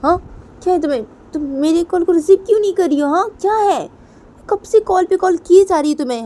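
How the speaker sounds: high, loud demanding; shrill phone scolding; high furious questioning on the phone; angry caller. Acted. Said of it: "तो तभी उधर से वो लड़की बोलती है".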